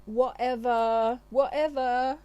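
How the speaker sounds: loud, high, drawn-out brush-off; dismissive. Retold or imitated. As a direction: wave him off with a loud whatever. Feminine dismissing him, exasperated.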